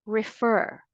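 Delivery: "Refer" as rih-FUR, final r sounded; American pronunciation. Shown as a demonstration. Teacher leading say it with me, American "Refer", rih-FUR. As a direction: stress the second syllable of "Refer", keep the r. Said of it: "the second syllable is stressed".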